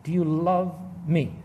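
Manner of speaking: slow, measured question; deliberate scripture quote. Acted. Preacher rereads verse sixteen as Jesus's question, slow and deliberate. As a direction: linger on "love", then place "me" apart.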